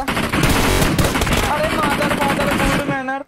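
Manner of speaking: loud, high yelling at an enemy; real startled gaming shout; excited yell. Real, not acted. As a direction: yell in the heat of a fight.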